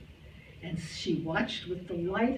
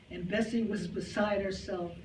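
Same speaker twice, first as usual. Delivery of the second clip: ordinary talk, nothing marked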